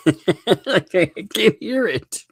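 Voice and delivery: high, helpless laughing; real amused laughter breaking into the words. Real, not acted. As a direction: say it through a laugh, pitch climbing. Amused by supposed Southern accent.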